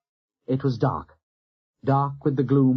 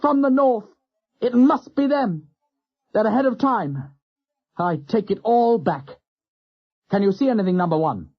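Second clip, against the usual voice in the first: exultant; 9 semitones higher, 4 dB louder than usual. A narrator's reading. Captain sure the squadron has arrived, exultant. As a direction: ride the wave of joy, calling out. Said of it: "Turner shouted exultantly".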